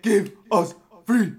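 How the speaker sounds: high, slow, loud cry in English; defiant shout again. Acted. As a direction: shout it proudly once he learned English. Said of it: "I learn English and I say".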